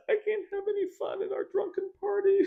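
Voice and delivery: high whiny complaint; hammed-up pouting whine; sulky wife. Voicing wife sulking.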